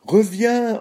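high, drawn-up call to come back; ordering. A narrator's reading. Hungry old man orders the fleeing cookie back, high call. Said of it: "ordonna le vieil homme".

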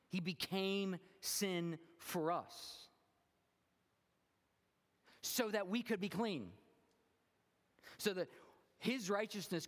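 high, loud, fervent sermon climb; full-force preaching. Acted. Pastor preaching to his congregation about Jesus's sacrifice, fervent and loud. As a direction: preach it out, building with passion.

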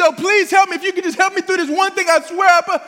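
high, urgent acted plea; crying out pleading. Acting someone in trouble crying out to Jesus, pleading. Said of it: "We can cry out to Jesus".

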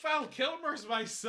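high, loud, slow disbelief; milk the soap-opera shock. Acted.

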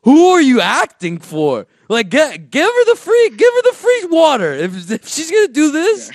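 loud, high-pitched mock outrage; exasperated rant. Fed-up passenger about the dry-heaving lady, loud high exasperation. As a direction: protest loudly, pitch climbing high.

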